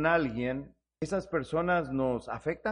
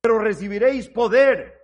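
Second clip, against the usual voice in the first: loud, forceful verse reading; declaimed scripture; 3 semitones higher, 9 dB louder than usual. Acted. Preacher repeating Christ's promise of power to the congregation, loud declamation. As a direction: proclaim it loudly.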